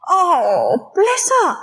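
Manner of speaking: high, choked-up and moved; a mother moved to tears by her child's goodbye; touched, teary. Retold or imitated. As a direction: melt at the child's words. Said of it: "And I'm going".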